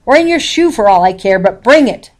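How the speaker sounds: loud, impatient demand; snapping. A narrator's reading. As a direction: push it out sharp and fed up. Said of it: "I snapped".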